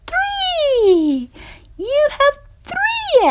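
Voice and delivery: sudden leap up high on the last count; counting eggs, then a high, thrilled three; excited reveal. Acted. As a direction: pop the number up in delight.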